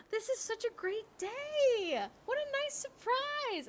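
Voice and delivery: high, slow, sing-song delight; mock-thrilled greeting to her best friend; faked delight. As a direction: play it as a sugary fake-surprised squeal. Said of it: "Blake showed up and I was like".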